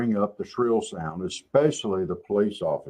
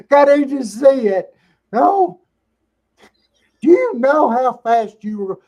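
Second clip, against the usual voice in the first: much higher, loud, shrill mock nagging; shrill nagging wife; 11 dB louder than usual. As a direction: squeal it like a scolding wife. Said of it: "they start to sound like a wife".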